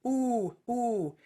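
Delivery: long rounded ooh vowel; sustained ooh. Shown as a demonstration. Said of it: "go into a ooh kind of sound".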